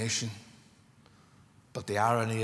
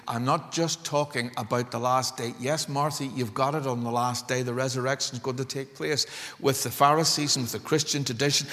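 normal talking voice

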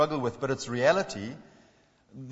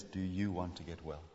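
tender; 8 semitones lower, 12 dB quieter than usual. Acted. Tender call, very soft.

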